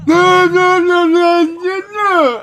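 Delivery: shrill-free squealing no, high and loud; high loud protest. Retold or imitated. Bum slaps the table and yells at them, high loud no's. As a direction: wail the no's like a tantrum.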